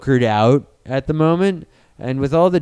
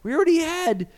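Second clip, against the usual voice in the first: much higher, whining; whiny complaint; 8 semitones higher than usual. Retold or imitated. A fan whines about the Spider-Man recast, much higher. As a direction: whine it out high like a let-down fan.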